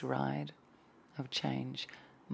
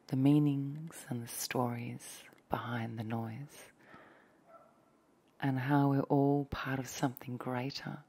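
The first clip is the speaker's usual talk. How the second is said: hushed dreamy ASMR voice; ASMR whisper. Acted. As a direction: breathe the words close to the mic like ASMR.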